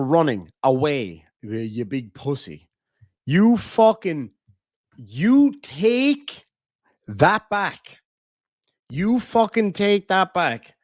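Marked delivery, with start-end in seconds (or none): 3.2-10.7 slow hammed-up indignant demand; mock outrage. To Sean after his insult, mock outraged. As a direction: pretend to be deeply offended.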